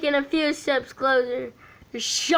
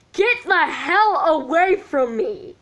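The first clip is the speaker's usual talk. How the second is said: higher, sharp angry dismissal; angry rebuff; 5 semitones higher than usual. Acted. Mark angrily telling Sean to back off. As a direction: push him away with anger.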